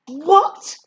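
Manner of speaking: loud, high indignant exclamation; sharply irritated; annoyed. A narrator's reading. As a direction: burst out in annoyed disbelief. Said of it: "Sadie sounds annoyed".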